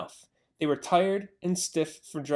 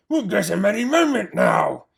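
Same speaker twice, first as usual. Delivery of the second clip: gruff farmer shout; 4 semitones higher, 7 dB louder than usual. A narrator's reading. Bogus shouting to Bean.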